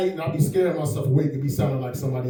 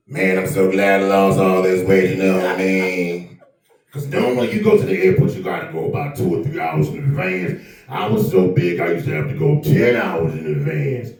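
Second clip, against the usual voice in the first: much lower, heavy comedian voice; Lavelle Crawford impression; 11 semitones lower than usual. Comedian imitating Lavelle Crawford at the airport, much lower. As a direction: drop way down into a big deep voice. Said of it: "This is Lavelle Crawford".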